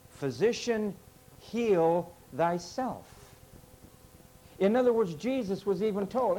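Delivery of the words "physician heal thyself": Physician, heal thyself drawn out, slow and weighty; solemn scripture quote, slow; slow proverb quote. Acted. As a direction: lean hard on heal, slowly. Quoting the proverb slowly.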